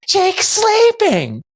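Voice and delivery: high, slow exaggerated whisper-plea; mock hush. Husband hushing his wife back, high and slow. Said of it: "I'm like".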